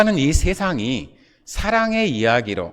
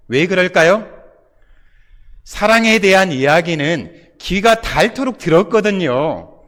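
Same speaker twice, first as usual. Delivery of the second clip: ordinary talk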